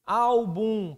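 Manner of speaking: "álbum" stretched loud on ál; first-syllable stress. Shown as a demonstration. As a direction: hold the ál of "álbum", then drop to bum. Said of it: "A correlação, ah, da minha acentuação". ("álbum" alongando forte o ál)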